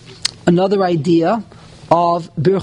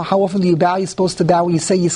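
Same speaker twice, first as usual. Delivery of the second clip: normal talking voice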